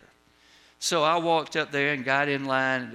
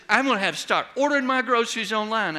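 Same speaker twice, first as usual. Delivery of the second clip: higher, louder and fast, exasperated; huffy complaint; 6 semitones higher, 4 dB louder, faster than usual. Retold or imitated. Fed-up shopper huffs at the cashier, higher, louder and quick. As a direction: blurt it out in an irritated huff.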